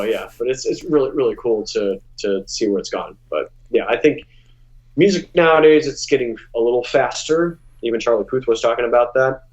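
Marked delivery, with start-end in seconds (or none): none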